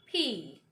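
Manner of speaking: P drawn out with a puff of air; aspirated letter name P; correct P. Shown as a demonstration. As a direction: say P carefully, falling. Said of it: "correct pronunciation of".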